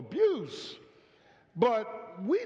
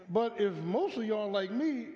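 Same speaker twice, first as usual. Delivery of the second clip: ordinary talk, nothing marked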